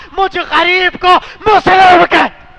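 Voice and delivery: loud, slow anguished cry. A narrator's reading. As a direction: drag out his name in pain. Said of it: "مسلم نے تڑپ کر کہا".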